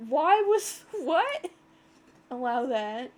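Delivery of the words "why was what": high, loud disbelieving cry; shocked. Real, not acted. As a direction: blurt it out in shock. Shocked at automod flag.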